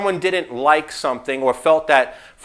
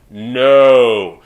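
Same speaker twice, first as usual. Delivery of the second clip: emphatic no; 6 semitones lower, 6 dB louder than usual. Acted. Answering his own question.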